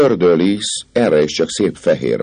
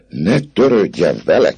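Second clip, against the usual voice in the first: a mild, raised exclamation; exclaimed; 7 semitones higher, 4 dB louder than usual. Acted. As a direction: cry out, upset, not full volume. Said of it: "kiáltott föl Fleur de Lis". (felkiáltó, kissé emelt hang)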